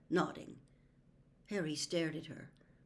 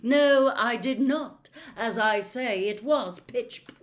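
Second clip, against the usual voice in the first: loud, offended snap; affronted; 5 semitones higher, 10 dB louder, slower than usual. A narrator's reading. Trelawney affronted by Harry's jibe, loud. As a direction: bristle at the insult.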